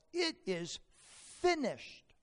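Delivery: a mild mock yell, drawn out slowly; loud cry. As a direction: call it out slowly from the cross. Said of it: "with a loud voice he yells".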